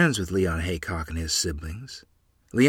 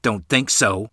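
ordinary delivery

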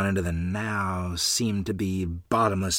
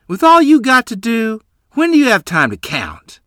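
higher, louder, mocking jab; half sneering; 12 semitones higher, 10 dB louder than usual. Mother mocking the neighbor's egg counting, half sneering. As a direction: sneer at her claim. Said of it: "half sneering".

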